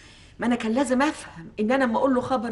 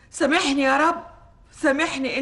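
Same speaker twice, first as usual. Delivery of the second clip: normal talking voice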